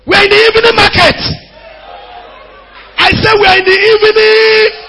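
high, loud, fast preaching cry; full-force preaching. Acted. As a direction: hammer home the evening message. Preaching climax.

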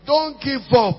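urgent preacher exhortation; urgent plea. Real, not acted. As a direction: press it on the church. Preacher urges congregation.

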